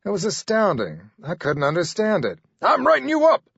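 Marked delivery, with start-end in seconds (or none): 2.6-3.4 furious supervisor. Acted.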